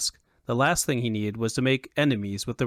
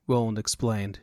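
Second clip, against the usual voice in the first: usual speaking voice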